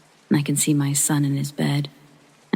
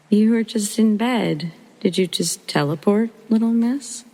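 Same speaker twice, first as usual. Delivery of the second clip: high, louder, nervously amused questions; half laughing, half baffled, pitched up; nervous amazement; 6 semitones higher, 3 dB louder than usual. Retold or imitated. Mother teasing her toddler, nervously amused and high. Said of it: "I start nervously laughing".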